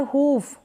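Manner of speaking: हूफ with a stretched oo; long oo in हूफ. Shown as a demonstration. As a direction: hold the vowel of हूफ long. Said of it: "हूफ नहीं है".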